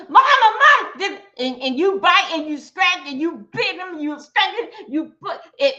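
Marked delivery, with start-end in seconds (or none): 0.1-0.9 put-on high, loud child's cry of mama, mama; mock child screaming for her mother; imitated scream for mama. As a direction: shriek for mama like a scared child.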